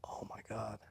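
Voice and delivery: breathless stunned whisper; whispering in shock at being offered opiates; whispered oh my God. Retold or imitated. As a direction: barely breathe it out in disbelief. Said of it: "And I'm like".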